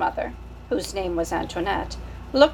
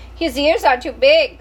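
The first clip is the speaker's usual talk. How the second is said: put-on higher and louder child voice; little sister; 6 semitones higher, 7 dB louder than usual. Sister Malot staring at the new baby. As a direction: complain like a small child. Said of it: "said his sister Malot".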